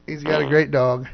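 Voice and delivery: ordinary talk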